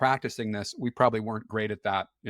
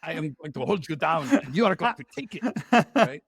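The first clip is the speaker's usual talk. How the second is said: put-on menacing Russian accent, higher pitched; mean Russian accent; 4 semitones higher than usual. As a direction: growl it like a mean Russian thug. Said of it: "my mean Russian accent".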